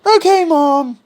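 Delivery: put-on high, childish kid voice; a high-pitched kids' chorus voice; kids answering mom. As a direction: chirp it like obedient little kids. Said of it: "So they're like".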